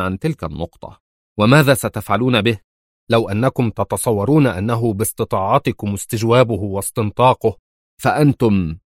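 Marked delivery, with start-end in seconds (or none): none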